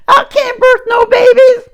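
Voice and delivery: high shrill crying shout of a panicked maid; Prissy's cry. Acted. Poet quoting Prissy from the film as a high wailing shout. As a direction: wail it high and loud, panicked. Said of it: "Prissy, you remember that foolish girl".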